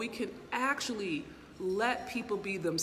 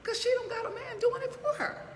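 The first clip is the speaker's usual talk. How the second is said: much higher, laughing mock outrage; high laughing voice mocking the haters; laughing disbelief. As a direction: laugh through an incredulous rant. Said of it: "I'm like".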